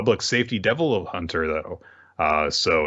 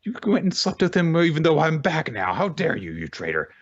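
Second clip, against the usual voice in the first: mock outraged Power; 7 semitones higher than usual. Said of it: "she's like".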